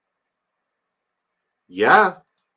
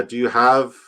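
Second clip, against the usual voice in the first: ordinary delivery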